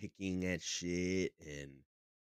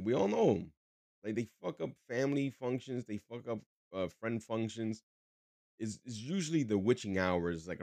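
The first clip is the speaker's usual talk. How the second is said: ordinary talk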